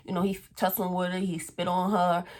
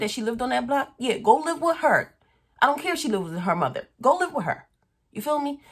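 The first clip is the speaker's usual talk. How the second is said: loud, higher, angry telling-off; heated rant; 4 semitones higher, 4 dB louder than usual. Retold or imitated. Confronting her cheating boyfriend, a loud angry rant. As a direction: throw it at him, furious.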